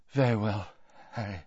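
fading to almost nothing; faint. A narrator's reading. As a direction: let it die away weakly. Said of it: "said Dumbledore faintly".

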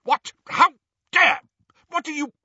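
high, loud indignant sputter; pained, outraged shriek. A narrator's reading. Portrait bumped into his frame, shrieking indignantly. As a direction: shriek it in broken bursts of outrage. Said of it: "shriek with pain".

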